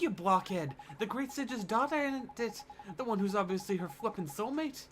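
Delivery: indignant fairy sidekick. Acted. Fairy Mister Drippy telling the boy he missed the obvious, exasperated. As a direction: scold the boy as a cheeky fairy. Voices the fairy in game dialogue.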